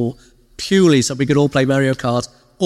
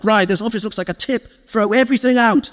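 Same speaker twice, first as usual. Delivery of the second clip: mock boss order; 8 semitones higher than usual.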